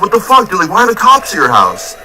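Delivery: high, stunned outburst of disbelief; shocked disbelief. Retold or imitated. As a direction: react like your friend just dropped bad news. Friend shocked, cops at house.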